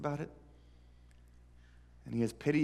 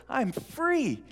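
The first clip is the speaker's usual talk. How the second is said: joyful shout; 12 semitones higher, 3 dB louder than usual. Acted. Skipping down the hallway shouting.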